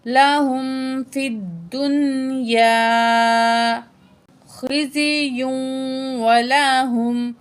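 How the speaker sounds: slow, held tajweed recitation; Quran recitation. Shown as a demonstration. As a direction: recite with long vowels and care. Verse recitation to close.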